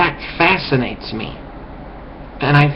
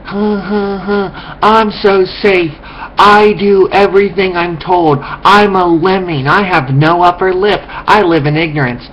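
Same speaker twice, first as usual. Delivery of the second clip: mock brainless follower; 6 semitones higher than usual. Speaker mocking people who feel safe, as a brainless follower. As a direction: play a happily oblivious lemming, pitched up. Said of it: "You're like".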